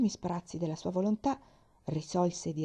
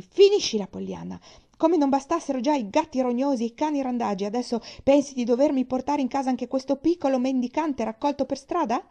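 angry scolding; 6 semitones higher, 8 dB louder than usual. Acted. Aunt scolds Pollyanna, springing up.

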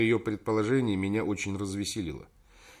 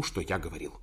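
plain reading voice

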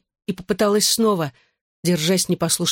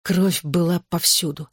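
plain reading voice

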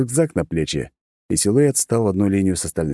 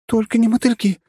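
horrified gasp; 4 dB louder than usual. Acted. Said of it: "ахнула Дэй".

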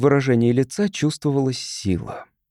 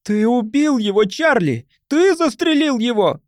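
outraged accusation; 10 semitones higher than usual. Acted. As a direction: accuse the killer before the crowd. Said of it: "гласом оскорблённого правосудия".

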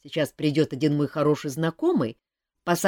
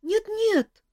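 high quick chick protest; urgent squeak. A narrator's reading. Chick refuses to leave Kantor, high squeak. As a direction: peep out a protest. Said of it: "пискнул цыплёнок".

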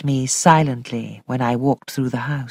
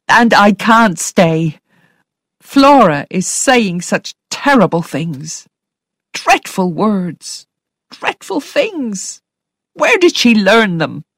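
tearful; 5 semitones higher, 4 dB louder, slower than usual. A narrator's reading. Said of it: "She was crying now".

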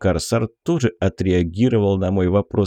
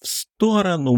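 wild yell; 9 semitones higher than usual. Acted. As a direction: scream the warning out. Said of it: "Диким криком заорал Кокос".

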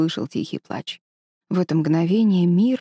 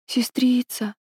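feverish; 5 semitones higher, slower than usual. Acted. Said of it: "прошептал ребёнок в бреду".

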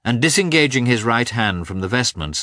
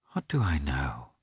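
anxious, breathy inner whisper; whispered thought; 5 semitones lower, 8 dB quieter than usual. Acted. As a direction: whisper it to yourself, worried.

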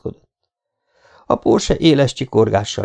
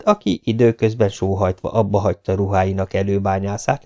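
ordinary talk

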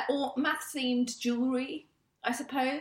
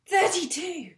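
mock breathless shout; 9 semitones higher, slower than usual. Mathematician bursting into the pub, shouting the number.

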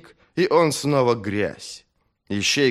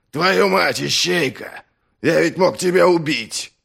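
angry shout; 8 semitones higher, 5 dB louder than usual. Acted. Startled warrior nearly killed friend.

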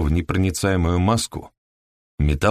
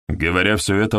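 usual speaking voice

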